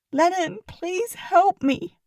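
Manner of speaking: sobbing plea. A narrator's reading. Pip begging Lennon for help, pleading and slow. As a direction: beg for help, slowly. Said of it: "I sobbed".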